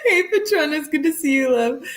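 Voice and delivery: high laughter into a greeting; laugh, then greet them. Real, not acted. Greeting a viewer while still laughing.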